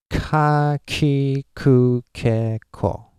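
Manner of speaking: KA, KI, KU, KE, KO drawn out with uneven, English-like stress; separate, weighty syllables on ka, ki, ku, ke, ko; English-style stressed syllables. Shown as a demonstration. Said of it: "an English speaker would go like".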